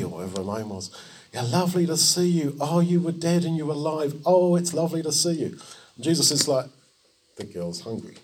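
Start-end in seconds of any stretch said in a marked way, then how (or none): 1.3-5.6 high, quick, delighted fussing; mock-excited gush in a raised pitch; gushing welcome. Fussing crowd greets revived girl. 7.4-8.2 low, soft, matter-of-fact; calm, softly dropped aside; understated interjection. Acted. Calm Jesus cuts through fuss.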